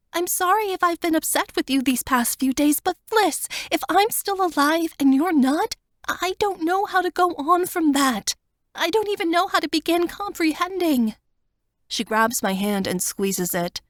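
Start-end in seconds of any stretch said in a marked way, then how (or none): 0.1-11.2 higher, tearful plea to her friend; Wanda's voice. A narrator's reading. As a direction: voice her pleading, higher and fuller. Wanda grieving to Fliss.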